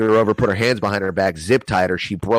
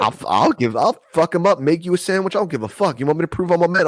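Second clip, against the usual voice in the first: much higher, amped-up mock bravado; hyped tough guy; 9 semitones higher than usual. Boasting to the girl he'll fight her ex, hyped. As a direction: puff up like a cocky kid. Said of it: "I was on some crazy two thousand and nine shit".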